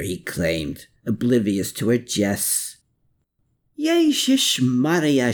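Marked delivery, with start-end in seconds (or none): 3.8-5.3 slow, drawn-out exclamation; cry out. A narrator's reading. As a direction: throw up your hands, exclaiming. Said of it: "she cried out".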